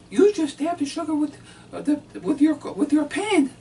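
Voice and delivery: high, loud put-on wife voice; mimicking his wife. Husband voices his wife catching his pen stunt, high and loud. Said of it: "And she said".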